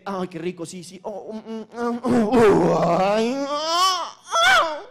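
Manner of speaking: hammed-up moaning that builds to a peak; staged ecstatic moans rising to a loud cry; mock orgasm. Acting out a model's orgasm.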